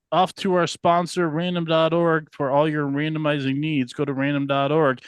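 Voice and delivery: upbeat sponsor announcer voice; ad read. Acted. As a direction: pitch it loud and high like an ad.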